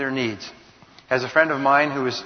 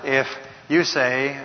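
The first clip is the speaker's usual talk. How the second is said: ordinary talk, nothing marked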